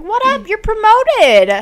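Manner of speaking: high-pitched cheery announcement; breezy king. Acted. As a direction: hand out the promotion in a high mock voice. Voices the king promoting them.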